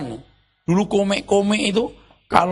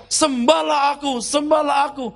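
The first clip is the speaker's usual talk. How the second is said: mock prophetic voice; 7 semitones higher than usual. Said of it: "Pakai acara".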